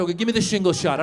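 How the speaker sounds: usual speaking voice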